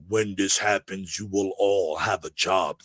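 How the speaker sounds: low, even robot announcement; robotic voice. Retold or imitated. Voice in his head foretelling the disaster, robotic. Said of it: "very robotic".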